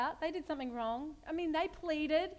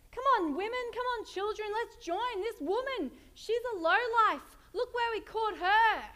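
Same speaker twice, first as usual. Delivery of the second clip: higher and louder, calling the crowd to join; rallying the crowd; 7 semitones higher, 5 dB louder than usual. Acted. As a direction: whip up the mob against her.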